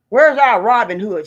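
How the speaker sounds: loud, insistent demand; shouted demand. Real, not acted. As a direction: call out for a champion to come. Angry call for rescue.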